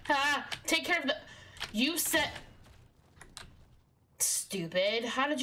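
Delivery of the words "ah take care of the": ordinary delivery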